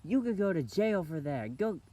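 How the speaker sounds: imitated telling-off, high and forceful; mock mad mom. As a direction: order the kid off like an angry mom. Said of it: "I'm mad at you".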